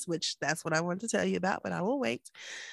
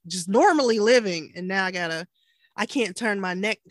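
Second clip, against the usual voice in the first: ordinary talk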